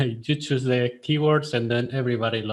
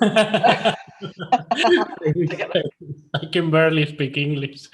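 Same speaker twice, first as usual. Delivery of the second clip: laughing; 5 semitones higher, 6 dB louder than usual. Real, not acted.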